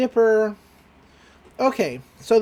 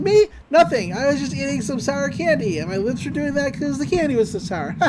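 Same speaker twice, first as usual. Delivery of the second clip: high-pitched feigned innocence; mock flustered excuse; 6 semitones higher than usual. Girl excusing her puckered lips, mock flustered. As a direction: cover up awkwardly, pitched up.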